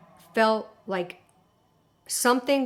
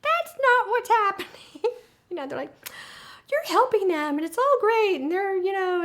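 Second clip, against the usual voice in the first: much higher, louder mock-cheery voice; mocking pro-alien friends in a squeaky, upbeat voice; 12 semitones higher, 5 dB louder than usual. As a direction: parody the true believers, high and gushing. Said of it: "the pro-alien types going".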